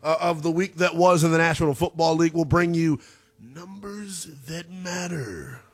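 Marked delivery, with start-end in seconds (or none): 3.4-5.6 slow, drawn-out big announcer voice; hushed, hammed-up big-voice announcer; big voice guy. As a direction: stretch each word like a movie-trailer voice guy. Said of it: "my big voice guy impersonation".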